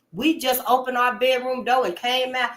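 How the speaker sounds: usual speaking voice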